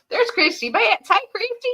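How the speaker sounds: high, bright hello to a viewer; excited greeting. Real, not acted. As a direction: call out a happy hello.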